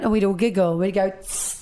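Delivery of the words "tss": soft hissing spray noise through teeth; quiet aerosol hiss. Retold or imitated. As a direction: make a deodorant spray sound. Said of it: "that's me making a noise with my mouth".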